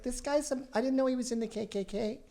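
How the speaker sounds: mock-shocked secretary discovering the boss was in the KKK. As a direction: pretend to be a scandalized secretary. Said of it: "secretary who was like".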